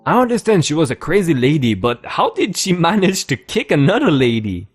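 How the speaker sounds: usual speaking voice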